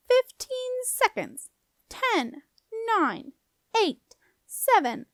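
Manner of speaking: high, drawn-out countdown; squeaky doorkeeper relishing each number; taunting squeak. A narrator's reading. Doorkeeper counting down to the deadline, taunting. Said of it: "came the doorkeeper's taunting squeak".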